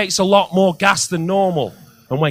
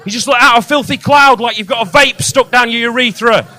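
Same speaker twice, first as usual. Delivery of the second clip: high, loud, exasperated rant; loud disbelieving tirade; incredulous rant; 7 semitones higher, 6 dB louder than usual. Real, not acted. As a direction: blow up at the absurd note. Ranting about the damp discharge note.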